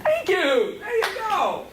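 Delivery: high mock-grateful praise; sardonic. Real, not acted. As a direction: thank them with dry sarcasm. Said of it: "sounds sardonic".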